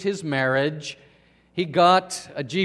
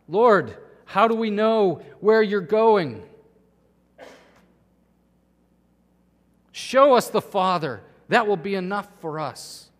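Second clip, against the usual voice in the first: louder, pleading outburst, mildly acted; a touch of upset, raised voice; upset blurting; 4 semitones higher, 5 dB louder than usual. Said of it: "he blurts out, uh, he's so upset".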